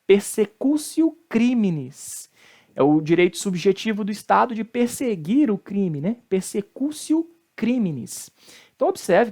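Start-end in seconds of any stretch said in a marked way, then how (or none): 0.0-2.3 slow persecutio, "persecúsio"; Latin T as C. Shown as a demonstration. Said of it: "o T com som de C". 6.3-8.3 slow persecutio, "persecúsio"; Latin T as C. Shown as a demonstration. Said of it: "o T com som de C".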